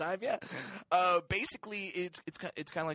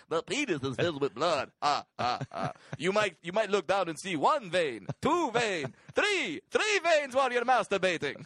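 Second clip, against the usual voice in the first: Count-style vampire voice, rising as he counts; Transylvanian accent; 5 semitones higher, 4 dB louder than usual. Retold or imitated. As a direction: do the Count from Sesame Street counting veins. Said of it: "a Transylvanian voice".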